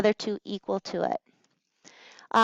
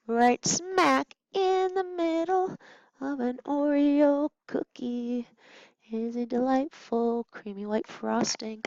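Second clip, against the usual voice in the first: savoring; 3 semitones higher, 4 dB quieter, slower than usual. Pretend. Playful cookie comparison.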